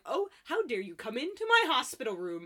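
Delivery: loud, rising mock outrage; ardent indignation. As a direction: play an affronted grandma hotly denying the charge. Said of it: "ardently she was defending this".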